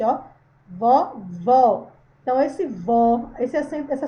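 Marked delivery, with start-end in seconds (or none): 0.7-1.9 "Vovó" with the mouth opened wide on ó; open, stronger vowel. Shown as a demonstration. Teacher demonstrates the acute accent, open strong "Vovó". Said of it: "abrir mais a boca pro som sair mais forte".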